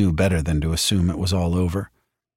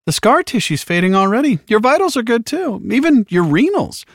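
very high, louder, relentlessly cheerful; chirpy medic; 13 semitones higher, 4 dB louder than usual. A narrator's reading. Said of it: "he'd chirped".

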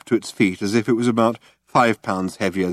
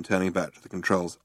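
plain reading voice

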